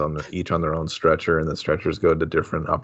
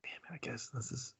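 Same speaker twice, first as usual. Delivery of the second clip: unvoiced despairing whisper; defeated whisper; 5 semitones higher, 24 dB quieter than usual. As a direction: mutter it under breath. Doctor despairs quietly.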